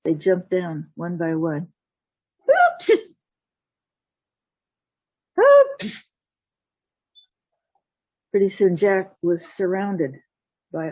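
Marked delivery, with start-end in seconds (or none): 2.5-6.1 high, loud popping whoop; sudden loud whoop, far above the usual voice; high yelped whoop. Acted. Storyteller voices cats jumping down one by one, high whoops.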